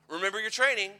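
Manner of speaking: loud, high order; mock drill shout. Instructors shouting during the rollover drill, loud. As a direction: call it out like an instructor. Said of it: "But they would say".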